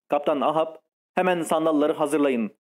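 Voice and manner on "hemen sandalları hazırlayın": ordinary delivery, nothing marked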